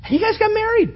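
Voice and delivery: high, bright exclamation; rising excitement. Real, not acted. Preacher exclaiming to the congregation about the wedding, high. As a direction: lift it up with joy.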